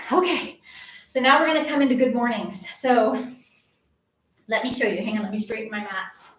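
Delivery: ordinary talk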